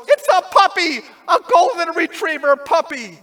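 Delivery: high, loud squeal of joy at the puppy; delighted surprise. Retold or imitated. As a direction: burst out with childlike delight. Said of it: "She goes".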